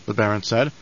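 ordinary delivery

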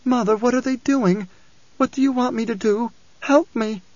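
put-on high, helpless child's voice; pleading little girl. A narrator's reading. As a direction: beg mother like a frightened small girl. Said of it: "pleaded in her little girl tones".

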